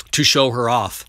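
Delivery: plain reading voice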